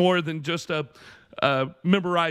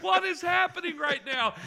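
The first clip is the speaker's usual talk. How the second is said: very high, strained cry; mock panic; 12 semitones higher than usual. Shocked boyfriend on stage mid-service, high mock panic. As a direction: wail it in exaggerated bewilderment. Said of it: "I was like".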